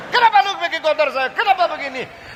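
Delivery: furious artist yelling at a worker; indignant outrage. Acted. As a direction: protest the ruined painting in disbelief. Painter furious at ruined fresco.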